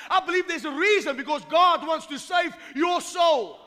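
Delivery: loud, high, fiery pulpit cry; full-force preaching. Acted. Preacher warning listeners away from hell, shouting. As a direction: drive it home to the congregation.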